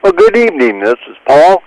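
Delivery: ordinary talk, nothing marked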